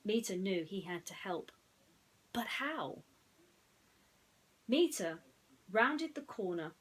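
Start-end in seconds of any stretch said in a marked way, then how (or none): 2.3-3.0 whisper. A narrator's reading. Narration question whispered.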